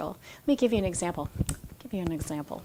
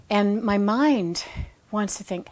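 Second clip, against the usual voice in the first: ordinary talk, nothing marked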